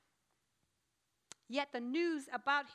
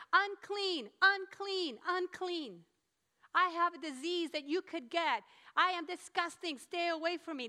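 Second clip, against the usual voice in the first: loud, higher cry of warning; warning yell; 4 semitones higher, 5 dB louder than usual. Acted. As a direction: yell it out to keep people away. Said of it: "they would have to yell".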